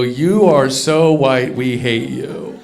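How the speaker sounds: slow, low, menacing mockery of the crowd; slow mock hostile voice. Comic mimicking people who misheard him, slow sneering menace. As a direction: drag it out like a sneering villain. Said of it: "and they go".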